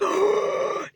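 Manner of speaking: high, sharp intake of breath; dramatic high gasp; shocked gasp. Retold or imitated. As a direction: gasp in dismay, acting out the shock. Retelling shock at being accused.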